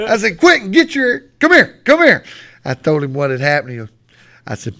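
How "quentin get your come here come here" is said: high, loud yell half laughing; hollered summons. Retold or imitated. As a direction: call him over urgently across the field. Retold coach calling in a player.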